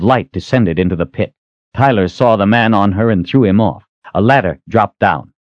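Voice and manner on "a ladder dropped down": ordinary delivery, nothing marked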